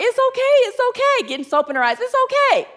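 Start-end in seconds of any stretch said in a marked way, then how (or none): 0.0-1.3 higher, quick, light mock comfort; lightly voiced sister brushing off the tears; cheerful reassuring sister. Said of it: "My sister's like". 2.0-2.7 higher, lightly voiced comfort; a hint of the sister soothing the crying stylist; cheerful reassuring sister. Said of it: "My sister's like".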